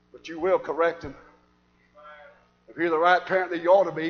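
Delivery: normal talking voice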